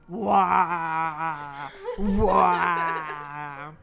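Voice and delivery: long drawn-out "wah", laughed through; mock wail. Player voices game character, long mock wail.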